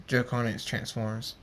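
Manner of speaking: ordinary delivery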